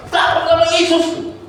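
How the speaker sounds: high, loud, drawn-out scream; hammed-up yell as the car flips; panicked cry. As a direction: shriek it out in mock terror. Acted cry in car overturning.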